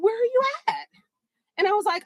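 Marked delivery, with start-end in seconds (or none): 0.0-0.9 high, chirpy imitation of the date on the phone; eager date voice. Imitating her date answering the phone, high and eager. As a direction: play the overeager guy, pitched way up. Date asks where she is.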